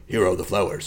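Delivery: low, flat, deadpan delivery; flat Hitman voice. Retold or imitated. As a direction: say it like a cold assassin. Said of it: "And it's like".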